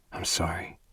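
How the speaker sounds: whispered sorrow; hushed apology. Acted. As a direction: breathe the apology out. Gray apologizes, near tears.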